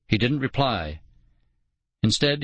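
plain reading voice

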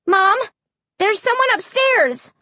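high, urgent cry. A narrator's reading. Child alerting Mom to someone upstairs, high urgent cry. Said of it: "I cried".

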